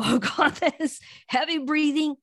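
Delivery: hyped-up panic. Retold or imitated. A coach imitates a hyperventilating, power-hungry person in mock panic. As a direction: play the frazzled, overwound self. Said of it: "All hyped up".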